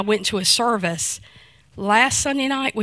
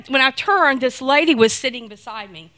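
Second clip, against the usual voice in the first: normal talking voice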